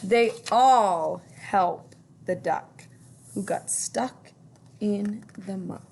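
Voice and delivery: plain reading voice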